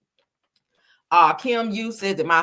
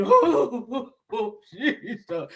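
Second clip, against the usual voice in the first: mock ugly cry; 4 semitones higher, much slower than usual. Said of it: "You gotta be".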